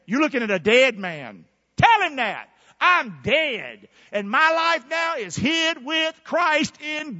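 loud, declaiming sermon delivery; forceful preaching. Real, not acted. Preacher telling Satan he is a dead man, forceful preaching. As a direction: hurl the scripture at the devil.

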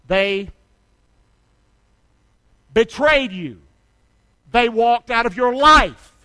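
loud, slow, building sermon; preaching at full force. Acted. Pastor preaching to the church about being shoved, loud and slow. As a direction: drive it home from the pulpit.

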